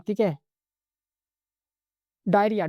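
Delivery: usual speaking voice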